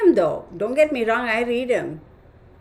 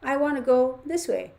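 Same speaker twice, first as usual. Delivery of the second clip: a light, higher stubborn-dog voice, mildly stubborn; 5 semitones higher than usual. Retold or imitated. Voicing the dog refusing the short walk, lightly stubborn. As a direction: voice the dog's mild protest. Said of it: "He's like".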